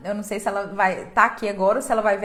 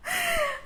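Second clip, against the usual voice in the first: tearful laugh; 4 dB quieter than usual. Real, not acted. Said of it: "eu tô emocionada aqui já".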